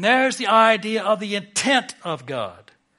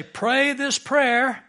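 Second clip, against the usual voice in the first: slow, raised, drawn-out instruction; mock prayer instruction; 7 semitones higher, 3 dB louder, much slower than usual. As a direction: imitate someone prescribing a prayer, slowly and higher. Voicing formula prayer promoters.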